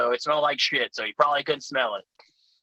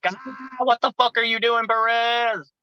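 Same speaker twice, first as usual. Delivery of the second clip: alarmed yell; 8 semitones higher than usual. Retold or imitated. Passengers to driver hauling down the wrong way, alarmed yell. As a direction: cry out in rising alarm.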